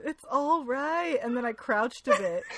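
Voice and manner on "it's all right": put-on slow, high, drawn-out voice as if to a lost child; mock soothing. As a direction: talk down gently and slowly like to a toddler. Voicing Fitz soothing the fool.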